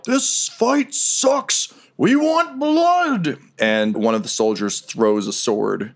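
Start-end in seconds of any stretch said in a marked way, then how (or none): 0.0-3.4 very high, drawn-out crowd heckle; high mock yell from the stands; heckler yelling. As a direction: stretch it out like a far-off spectator yelling. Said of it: "you hear someone yell".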